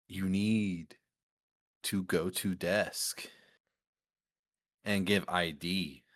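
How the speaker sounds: slow, halting words with long gaps; drawn-out, stilted instruction; odd-talking bar guy. Retold or imitated. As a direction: imitate a guy doling out words one at a time. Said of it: "why he's talking like this".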